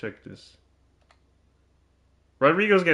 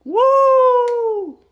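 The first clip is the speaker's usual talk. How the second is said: very high, loud woo; thrilled shout; excited whoop; 6 dB louder than usual. Real, not acted. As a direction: cheer a big play.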